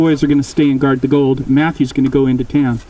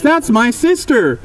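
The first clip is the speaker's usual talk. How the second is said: slow, high, stricken; stunned brother; 9 semitones higher, slower than usual. Acted. Matthew realizing the dead girl is his sister, stricken. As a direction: let it land slowly, pitched up in dismay.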